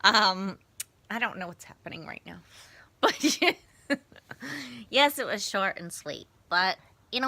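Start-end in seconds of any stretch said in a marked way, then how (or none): 1.1-3.0 fast whisper. Real, not acted. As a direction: whisper through a laugh. Someone in her face.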